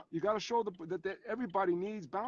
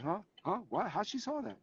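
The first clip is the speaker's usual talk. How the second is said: put-on a touch of a confused kid, higher; baffled kid; 3 semitones higher, 4 dB quieter than usual. Kid caught by the teacher on camera, mildly baffled. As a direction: caught out and puzzled. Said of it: "And the kid's like".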